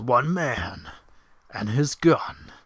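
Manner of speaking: commercial voiceover. Pretend. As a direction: boom it like a movie ad. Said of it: "the commercials".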